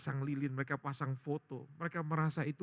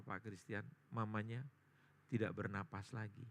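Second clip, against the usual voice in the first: ordinary delivery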